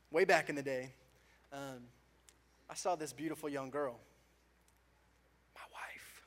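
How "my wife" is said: hushed, breathy aside; quick whispered aside to the congregation. Real, not acted. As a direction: drop to a whisper for the reveal. Aside whispered about his wife.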